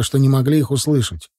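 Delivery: usual speaking voice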